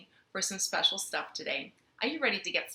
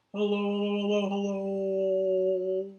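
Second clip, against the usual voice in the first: low hellos. Shown as a demonstration. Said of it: "say hello as low as we can".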